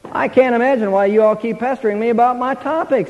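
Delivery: ordinary talk, nothing marked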